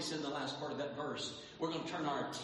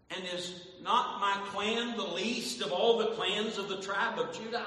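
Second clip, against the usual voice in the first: Saul protesting, louder and higher; louder pleading Saul; 6 semitones higher, 6 dB louder than usual. Acted. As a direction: protest that nobody would listen. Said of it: "this is what Saul was saying".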